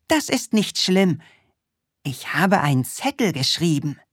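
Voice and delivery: put-on high, eager little boy voice; Krümel voice. Little Krümel inviting the witch to a party, high and eager.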